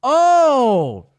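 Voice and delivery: long, loud, high "oh"; drawn-out oh as it suddenly clicks; big acted realisation, swooping down; dawning realisation. Retold moment of sudden realisation.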